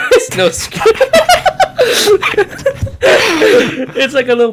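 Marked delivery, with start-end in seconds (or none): 0.0-0.9 breathless laugh through words. Real, not acted. Laughing at friend's guess. 1.0-3.9 big burst of laughter; loud high laugh. Real, not acted.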